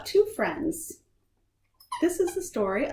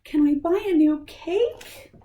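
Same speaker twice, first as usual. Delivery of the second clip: put-on higher, louder pleading kid voice; hopeful kids; 8 semitones higher, 7 dB louder than usual. Kids asking mom for a new cake, high and pleading. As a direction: ask like small kids hoping for an easy fix. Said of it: "says Sweetie and Fuzz".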